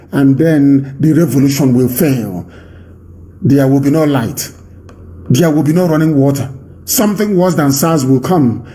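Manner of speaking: usual speaking voice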